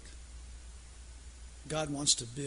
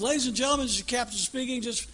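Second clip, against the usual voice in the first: higher, louder captain, getting nervous; growing mock captain anxiety; captain's announcement; 5 semitones higher, 5 dB louder than usual. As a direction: reassure the passengers a bit too hard.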